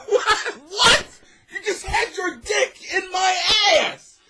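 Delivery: hammed-up horror at the betrayal; mock outraged disbelief. Pretend jail victim cries out at his cellmate, shocked and outraged.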